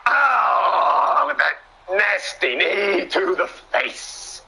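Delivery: high, stretched-out "oh" and slow relish; excited reaction call. Real, not acted. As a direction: wince aloud at a huge hit. Commentator reacts to nasty knee.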